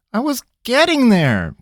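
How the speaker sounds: wounded little protest; complaining. Acted. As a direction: whine that he's been interrupted. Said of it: "Pickett complained".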